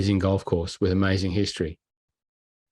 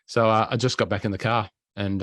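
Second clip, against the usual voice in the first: ordinary talk, nothing marked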